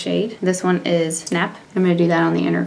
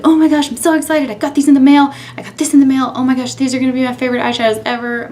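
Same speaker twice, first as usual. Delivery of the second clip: higher, louder mock gush; excited gushing; 6 semitones higher, 4 dB louder, faster than usual. Vlogger re-enacting getting the palettes in the mail, excited gushing. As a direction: gush excitedly, imitating your own first reaction. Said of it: "I was so excited".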